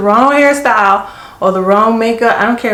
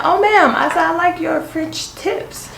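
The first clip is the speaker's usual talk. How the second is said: sweet polite compliment; 9 semitones higher, 3 dB quieter than usual. Retold or imitated. Flattering a colonel about nails.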